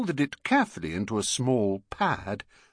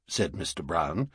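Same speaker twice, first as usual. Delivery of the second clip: plain reading voice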